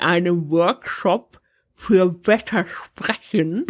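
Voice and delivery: talking around a cork; muffled speech with a cork held between teeth; cork in mouth. Real, not acted. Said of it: "da steckst du dir einen Korken in den Mund".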